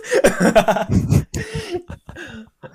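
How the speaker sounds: long, very high falling laugh. Real, not acted. Laughing about friends as followers.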